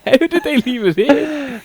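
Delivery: squealing, slow; shrieking through laughter, slowly. Retold or imitated. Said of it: "I started screaming".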